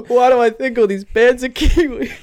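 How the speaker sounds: high, loud, laughing question. Real, not acted. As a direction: laugh through the words. Laughing at own mistake.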